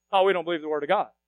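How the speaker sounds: ordinary talk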